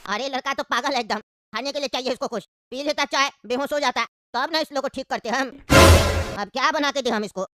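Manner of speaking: put-on low, easygoing old uncle. Uncle plots aside.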